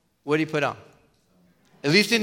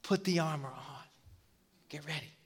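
gentle warning; 11 dB quieter, slower than usual. Retold or imitated. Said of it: "And God's saying".

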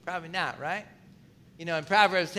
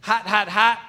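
hot; 5 dB louder than usual. Shown as a demonstration. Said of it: "if you're coming in hot".

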